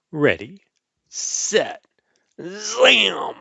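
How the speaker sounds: building to a high, loud zam; countdown cheer. Acted. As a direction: launch the machine with a big shout. Launching the time machine.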